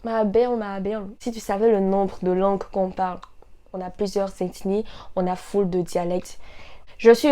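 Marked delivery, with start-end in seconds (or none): none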